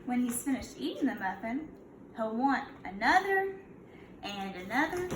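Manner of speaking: put-on storybook read-aloud for kids; a gentle teacher reading to her class; reading to children. Teacher reads picture book aloud.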